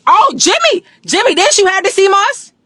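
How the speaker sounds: loud high call. Real, not acted. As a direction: shout across the room to Jimmy. Calling out to Jimmy.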